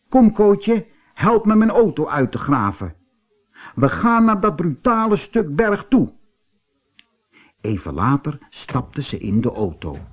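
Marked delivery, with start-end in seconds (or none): 0.1-6.1 higher-pitched indignant aunt; Tante Eefje, furious. A narrator's reading. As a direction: give orders with mild acted anger. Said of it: "Tante Eefje was woedend". (verontwaardigde, bevelende tante)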